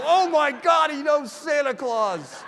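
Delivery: put-on very high, loud, amazed kid voice, slowing at the end with a laugh; kids' amazed outburst. Kids stunned that Santa knows Terry, very high amazed squeal. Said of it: "the kids go".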